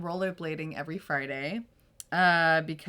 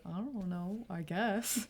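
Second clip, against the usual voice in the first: imitated half-hearted answer, much softer; noncommittal mumble; 9 dB quieter, slower than usual. Eighth-grade boy answering the friend, noncommittal.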